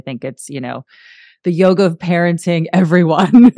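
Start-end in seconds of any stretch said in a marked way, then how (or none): 1.4-3.5 mock announcement. Proclaiming a new subtitle.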